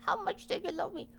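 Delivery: very high, fast squeaky question; high fast cartoon squeak; squeaky little one. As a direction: rattle it off in a tiny critter voice. Little bear voiced high, fast.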